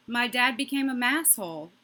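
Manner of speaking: ordinary talk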